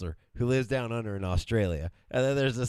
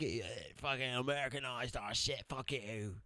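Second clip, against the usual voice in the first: soft grumbling mock Aussie; mock Australian; 9 dB quieter than usual. Husband imagines Australians grumbling about the film, soft mock accent. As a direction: mutter it like an annoyed Aussie. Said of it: "or is that like".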